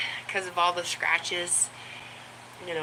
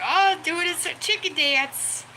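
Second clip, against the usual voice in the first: put-on baby talk to the dog; 5 semitones higher than usual. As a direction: coo over the good boy in a high voice. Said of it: "I was like".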